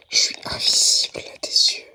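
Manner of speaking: unvoiced hiding whisper. Real, not acted. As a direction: whisper so it can't hear. Hiding from monster.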